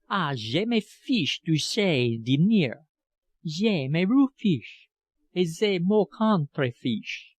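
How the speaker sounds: put-on slow, dramatic French accent; theatrical French governess. A narrator's reading. Governess brushes off fear of the gardener, slow theatrical French. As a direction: savour each fiche like a stage heroine. Miss Wilkinson's voiced French lines.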